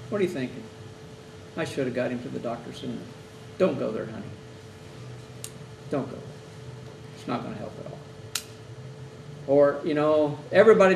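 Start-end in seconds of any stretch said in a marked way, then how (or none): 0.1-3.0 softly voiced guilty relative; quiet self-blame. Guilty relative voiced quietly, blaming himself. 3.6-8.0 soft, gentle reassurance; tender comfort. Shown as a demonstration. Chaplain gently comforting a self-blaming relative, tender.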